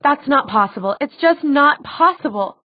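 rising, insistent denial; upset disbelief. A narrator's reading. Daughter refuses the news about her father, upset disbelief.

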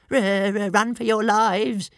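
high, loud, slow stammering little dragon yelp. A narrator's reading. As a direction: squeal it in panic like a tiny dragon. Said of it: "yelped Toothless".

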